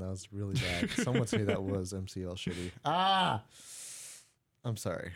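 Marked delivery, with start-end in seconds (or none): 2.9-3.4 sudden high yell; short outburst into the mic. Real, not acted. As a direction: burst out with a loud "Ah!". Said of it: "shouldn't yell into the mic".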